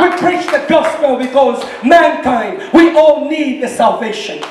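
rising, fervent sermon voice; impassioned preaching. Real, not acted. Preacher testifying about the cross, fervent and building. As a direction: build with urgent conviction to a peak.

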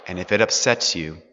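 calm surrender to God's will; quiet resolve. Acted. Jacob telling Joseph he obeys God, quiet and firm. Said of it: "which Jacob comes to say".

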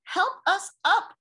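high loud call for help; crying out. Acted. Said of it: "cried A B C".

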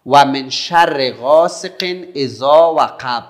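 loud, slow, drawn-out recitation; measured, projected Quran teaching voice; recitation model. Shown as a demonstration. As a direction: call out the verse slowly for pupils to repeat. Models Quran verse for pupils to repeat.

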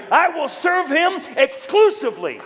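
high, loud vow; declaring loyalty. Acted. Preacher voicing a believer's bold vow, loud. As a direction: proclaim it like an oath.

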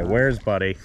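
normal talking voice

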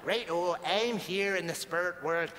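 Northern Irish sliding into mock Mexican. Medium adding Mexico to dead dad's voice, mixed Northern Irish-Mexican accent. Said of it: "he spent a lot of time in Mexico".

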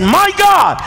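high-pitched, exasperated cry to God; frustrated outcry. Retold or imitated. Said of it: "he was so frustrated that he said".

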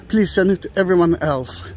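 usual speaking voice

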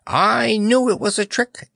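high-pitched gleeful accusation; triumphant exclamation. A narrator's reading. Courtier pounces on the clown's admission, high and triumphant. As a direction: exclaim it high, as if proved right. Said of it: "exclaimed Panapee triumphantly".

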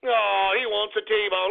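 ordinary talk, nothing marked

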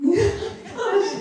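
high burst of laughter into a gosh; laughing. Real, not acted. Amused at comparing rejection to a cold, laughing. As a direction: laugh at your own joke, then gosh.

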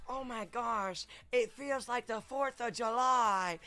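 breathy-free drawn-out starstruck gush, slow and lifted; Jennifer Coolidge impression. Streamer channels a celebrity for chat, slow gushing impression. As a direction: purr it out like a dreamy celebrity. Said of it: "I'm channeling my inner Jennifer Coolidge".

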